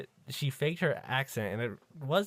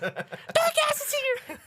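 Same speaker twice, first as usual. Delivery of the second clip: high, slow, loud, laughing shout; laughing mock alarm; 5 dB louder, slower than usual. Host giggling while faking panic at the monster. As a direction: scream the warning through laughter.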